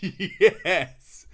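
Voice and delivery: retold burst of joy; overjoyed yes. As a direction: let out a happy, relieved yes. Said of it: "can't tell you how happy I was".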